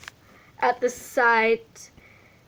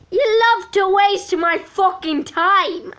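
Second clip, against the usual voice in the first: louder, higher angry snarl; growled; 8 semitones higher, 5 dB louder than usual. Acted. Dark Sean snarling at Mark, louder. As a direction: snarl it with contempt. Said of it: "Sean growled".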